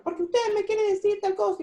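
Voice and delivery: reclamo gritado, muy agudo; gritos imitados. Acted. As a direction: imitar a alguien que empieza a gritar. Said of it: "empieza a gritar". (protesta a gritos, con voz aguda)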